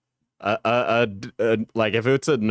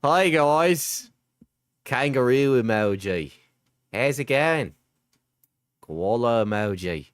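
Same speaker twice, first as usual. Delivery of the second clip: mock Australian; slower than usual. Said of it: "Here's how you speak Australian".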